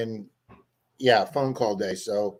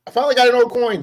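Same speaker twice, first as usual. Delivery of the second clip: excited; 9 semitones higher, 8 dB louder, faster than usual. Real, not acted. Finally finds an old coin.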